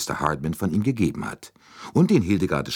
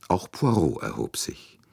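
plain reading voice

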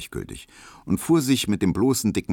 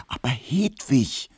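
slow, pleading whisper; urgent whisper; 7 semitones higher, slower than usual. Acted. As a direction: whisper it closer, insisting. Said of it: "flüsterte Emil Kubinke eindringlich". (eindringliches Flüstern)

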